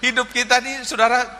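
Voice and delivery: high, laughing through the point. Real, not acted. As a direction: say it still amused. Laughing after child's joke.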